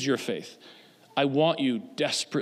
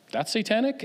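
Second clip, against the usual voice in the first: incredulous question; 8 semitones higher than usual. Retold or imitated. Answers accuser with questioning quote.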